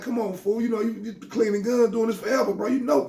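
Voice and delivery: high, scolding rant at a friend; scolding a friend for careless gun handling; going off on him. Retold or imitated. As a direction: chew him out, half laughing. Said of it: "I'm going off on him".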